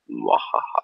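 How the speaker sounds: low hammed-up villain cackle; a mock mad scientist laugh; evil laugh. Villain laugh after joking threat.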